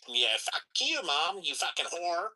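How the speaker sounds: put-on kid voice, drawn out and rising high; mock bratty kid. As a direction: imitate a kid sassing mom.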